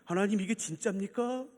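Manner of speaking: soft, hushed address to God; subdued, earnest acted prayer; questioning prayer. Shown as a demonstration. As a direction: ask in quiet disbelief. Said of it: "이렇게 기도하세요".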